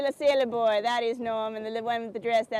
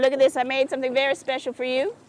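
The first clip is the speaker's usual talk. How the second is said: normal talking voice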